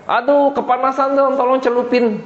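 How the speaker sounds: high-pitched, urgent plea for relief; pleading rich man. Acted. Rich man in hell begs to be dipped, high plea. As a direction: beg for help from the heat. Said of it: "orang kaya".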